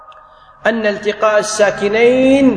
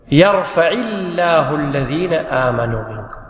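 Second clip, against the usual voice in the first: slow, lower, measured recitation; verse recitation; 6 semitones lower than usual. Shown as a demonstration. Teacher quotes a verse as an example, slow recitation. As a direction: recite the verse slowly and deliberately.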